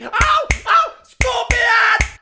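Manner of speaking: high, loud cries of pain; pained yelps. Acted. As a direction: yelp as if stung. Punished by scorpion, yelps in pain.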